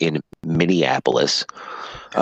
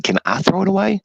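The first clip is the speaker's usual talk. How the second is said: exasperated question. Retold or imitated. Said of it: "And I said".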